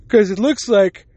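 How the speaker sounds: talking through a yawn; words stretched by a yawn. Real, not acted. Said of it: "keep yawning".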